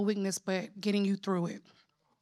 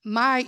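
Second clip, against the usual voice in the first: "My" lifted and louder; stress on My; 3 semitones higher, 4 dB louder than usual. Shown as a demonstration. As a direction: announce "My" as the key word. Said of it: "equals God himself as the source".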